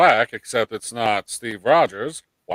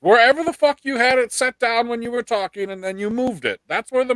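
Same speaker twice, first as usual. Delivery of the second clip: exasperated; 11 semitones higher than usual. Real, not acted. Scolding friend about microphone.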